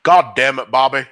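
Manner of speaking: loud, exasperated cartoon dad; Hank. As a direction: scold your son as a fed-up cartoon father. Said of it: "well, that's Hank".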